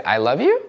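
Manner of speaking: YOU rising at the end; rising question tone. Shown as a demonstration. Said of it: "if it goes up".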